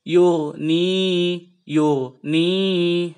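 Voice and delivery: long-vowel Yurni. Shown as a demonstration. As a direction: stretch the vowel in Yurni two beats. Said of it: "jadi panjang seperti ini".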